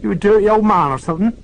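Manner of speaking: fast, high, loud Oxfordshire jab; Oxfordshire woman, accusing. Acted. Oxfordshire woman accusing Lewis on the phone. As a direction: fire it back in an Oxfordshire accent. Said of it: "her curly Oxfordshire accent".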